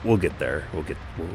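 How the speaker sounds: normal talking voice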